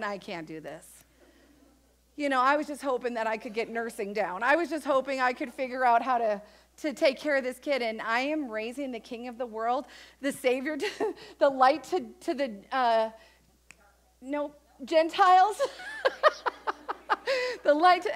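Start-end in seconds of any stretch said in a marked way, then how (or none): 0.0-0.8 much lower and softer, a defeated murmur; overwhelmed Mary. Retold or imitated. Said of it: "all of a sudden going". 15.7-17.6 a bright embarrassed laugh at herself; high laugh. Real, not acted. Laughing at her own stumble.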